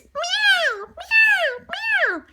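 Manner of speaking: high, slow meowing; cat meows. Acted. Cat calling the puppies with high meows. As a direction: meow like a little cat.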